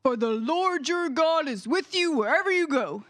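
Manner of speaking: low, loud deep voice. Shown as a demonstration. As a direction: rumble it from the chest. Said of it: "let's try a deep voice".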